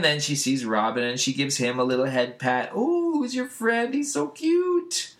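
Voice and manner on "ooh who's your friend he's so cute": very high, drawn-out falsetto; put-on doting squeal; gushing coo. Elastigirl gives Robin a head pat, mock-adoring.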